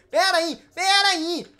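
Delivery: loud, rising call to wait; urgent halting. Real, not acted. Host halting players mid-game, calling out. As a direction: call out to stop them.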